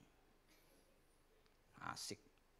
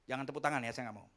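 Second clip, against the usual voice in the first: ordinary talk, nothing marked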